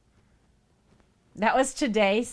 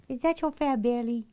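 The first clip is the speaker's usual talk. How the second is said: higher, louder cooing at the cat; baby talk to pet; 6 semitones higher, 5 dB louder than usual. Acted. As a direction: coo it like talking to a chubby pet. Talking baby talk to her cat.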